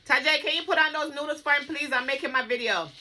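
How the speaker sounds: loud, high, hurried call across the house. Real, not acted. Mother asks Tajay to cook noodles, calling out. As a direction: call the request through to another room. Calling child in another room.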